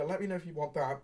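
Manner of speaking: usual speaking voice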